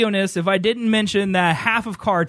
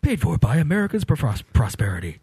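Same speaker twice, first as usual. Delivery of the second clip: ad disclaimer voice; 5 semitones lower than usual. Retold or imitated. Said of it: "And paid for you by".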